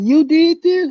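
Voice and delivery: very high, loud amazed ask; astonished. Retold or imitated. Principal asking the teller, high astonished. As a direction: gasp it in disbelief. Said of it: "they're like".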